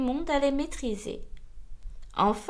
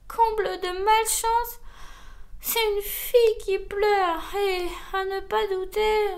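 sighing lament; 6 semitones higher than usual. A narrator's reading. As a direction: lament your bad luck in a high voice. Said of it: "soupirait-elle".

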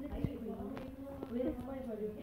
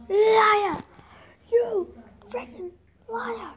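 higher, much louder outraged yell; furious shout; 9 semitones higher, 12 dB louder, much slower than usual. Real, not acted. Gamer betrayed by the murderer, furious shout. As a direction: scream betrayal at the screen.

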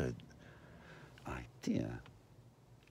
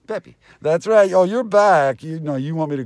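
much higher and louder, calling out; loud greeting; 8 semitones higher, 14 dB louder than usual. Real, not acted. Greeting Peppy loudly as he comes back. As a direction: call out as a friend walks in.

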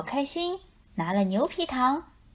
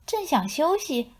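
ordinary delivery

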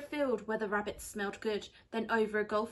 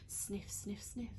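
hushed unvoiced sniffing; whispered; 8 dB quieter, slower than usual. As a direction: whisper it, tense and secret. Tense moment, sniffing quietly.

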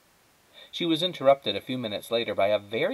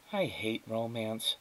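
to herself; 8 dB quieter, slower than usual. A narrator's reading. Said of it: "said Flora out loud to herself".

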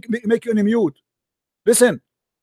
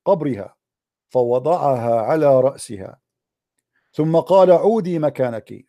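solemn story reading; 6 semitones lower than usual. Acted. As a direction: read it out deliberately to the audience. Reads a miracle story aloud.

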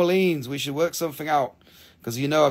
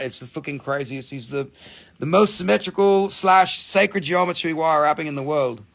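ordinary talk, nothing marked

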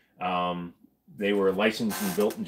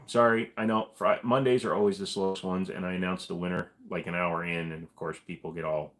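ordinary talk, nothing marked

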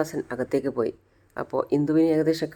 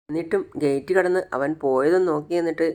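ordinary delivery

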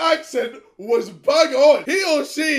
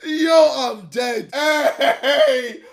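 ordinary talk

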